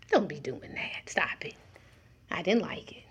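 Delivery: a near whisper, tender but firm; whispered correction. Pretend. God close beside you, whispering a tender rebuke. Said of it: "he don't have to yell across the field".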